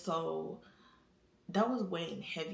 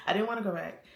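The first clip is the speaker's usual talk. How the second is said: ordinary delivery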